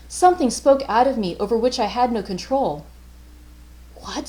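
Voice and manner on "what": a soft, startled breath of a question; a hushed What, caught off guard; under her breath. A narrator's reading. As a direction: barely voice it, shaken. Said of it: "said Missus Reed under her breath".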